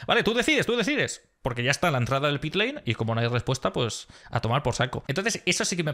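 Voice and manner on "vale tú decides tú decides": high mock shout of a driver out of time; retold panicked shout. Driver shouting at engineer on the radio, high panicked mock yell. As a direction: yell it fast as the pit entry nears. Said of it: "Está gritando".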